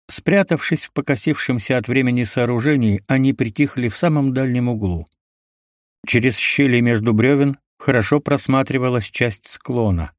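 ordinary delivery

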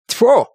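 loud high "tfu" of disgust; disgusted spit. A narrator's reading. House spirit scorning slang, loud spit. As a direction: spit out the sound in contempt.

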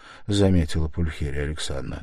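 usual speaking voice

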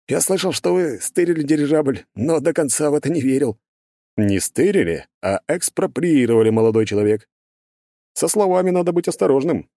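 smug, lecturing character voice. Acted. Burbulis speaks over radio.

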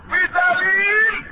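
ringing sermon declamation rising high at the end; full-force preaching. Acted. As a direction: proclaim the proof from the pulpit. Preaches the proof in God's voice.

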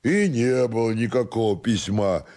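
soft, tipsy denial; drunken stubborn servant. Acted. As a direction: deny it quietly and doggedly, drunk. Drunken servant denies letter.

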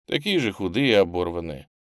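ordinary delivery

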